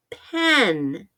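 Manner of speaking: normal talking voice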